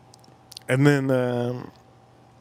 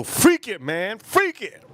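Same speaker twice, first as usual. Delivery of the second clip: high-pitched carefree exclamation; high excited exclaim; 8 semitones higher, slower than usual. Retold or imitated. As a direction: toss it off with high glee. Retold reckless wine choice.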